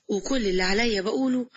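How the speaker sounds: usual speaking voice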